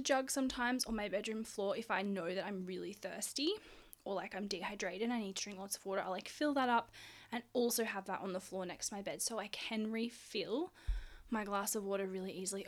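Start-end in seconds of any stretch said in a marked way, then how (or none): none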